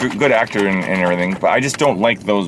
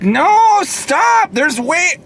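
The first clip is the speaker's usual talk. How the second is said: yelling stop; much slower than usual. Real, not acted. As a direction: shout it down in disbelief. Outburst protesting the idea.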